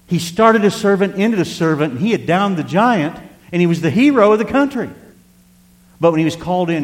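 loud, high, ringing proclamation; full-force preaching. Acted. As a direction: proclaim it to the whole congregation.

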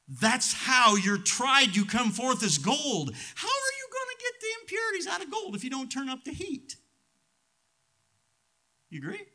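rising, shouted sermon climax, high and loud; preaching at full force. Acted. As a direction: build the fire and preach it out. Preaching climax on gold and heat.

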